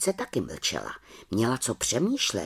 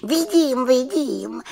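put-on high girlish voice, impatient; a high child-witch brushing him off; little witch; 13 semitones higher, 4 dB louder, slower than usual. Little witch answers the raven, high and impatient. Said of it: "řekla malá čarodějnice".

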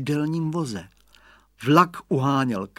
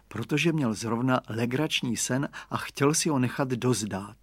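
usual speaking voice

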